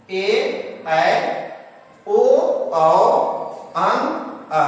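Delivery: teacher's paired vowel drill; vowels in pairs. Shown as a demonstration. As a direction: separate vowel sounds, recited two by two. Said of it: "जोड़ा में इसको उच्चारण देंगे".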